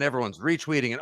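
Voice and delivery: normal talking voice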